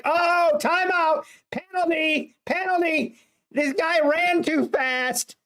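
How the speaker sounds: high, whiny protest, slowing at the end; mock whiny complaint. Mocking a defender who cries to the ref, high and whiny. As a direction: whine like a crybaby to the ref. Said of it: "It's like".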